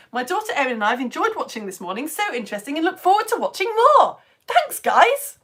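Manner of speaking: Maddie Moat impression. Science teacher imitating a children's TV presenter, lightly bubbly. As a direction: read it like a cheery CBBC host. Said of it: "that was my Maddie Moat impression".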